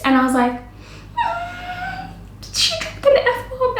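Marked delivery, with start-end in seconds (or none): 1.2-2.1 thin, very high quiet squeal; stifled squeal. Retold or imitated. Makeup artist reacts to client's swearing, mock shocked squeal. 2.5-3.8 high, stretched squeak of disbelief; mock shock. Makeup artist reports client's F-bomb, mock scandalised. Aghast retelling of client's swearing.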